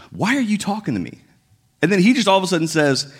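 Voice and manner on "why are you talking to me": higher, taken-aback question; startled woman. Retold or imitated. Woman startled that this man speaks to her, pitched-up surprise.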